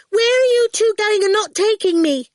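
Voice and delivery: high, loud piping demand; demanding child. A narrator's reading. Awful to the two leaving without her, demanding. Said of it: "she demanded".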